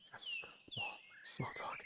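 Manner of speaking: breathy mock whisper; whispered aside. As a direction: hush it out, mocking the dialogue. Mocking repeated game dialogue.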